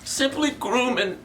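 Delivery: choked, voice dropping out; getting emotional. Real, not acted. Host just wants viewers to groom themselves, choked with emotion. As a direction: plead as if overcome, then apologise almost voicelessly. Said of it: "I'm getting a little emotional".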